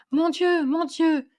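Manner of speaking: put-on high, excited old man crying out; bursting with joy and bewilderment; elderly man exclaiming in high, flustered delight; overjoyed exclamation. A narrator's reading. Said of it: "courir égaré dans la chambre en faisant".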